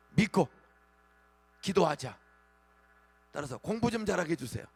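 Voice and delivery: loud, rousing sermon delivery; fiery preaching. Acted. Pastor rousing his congregation with loud fiery preaching. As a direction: preach it hard to stir the congregation.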